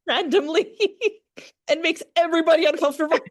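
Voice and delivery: words spilling out between real laughs; real amused laughter breaking up the words; laughing through it. Real, not acted. Laughing at daughter's antics.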